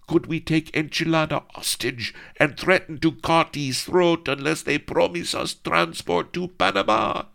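put-on high, eager girlish voice; lightly voiced scheming damsel; girl proposing a wild plan; eager maiden.

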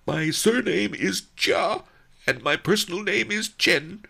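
high, loud, whiny nobleman, fast once he gets going; a besotted official moaning out his devotion; moaning lovesick governor. A narrator's reading. The governor on his knees moans his love to Lotus Cloud. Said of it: "he moaned".

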